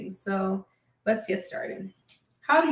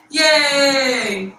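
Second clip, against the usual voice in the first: excited cheer; 3 semitones higher, 9 dB louder than usual. Real, not acted. Celebrating finished lesson.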